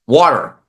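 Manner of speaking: "water" drawn out slowly, the final R barely there; New York pronunciation. Shown as a demonstration. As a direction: say "water" with an "aw" middle, as in coffee. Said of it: "I barely pronounce the R in the end".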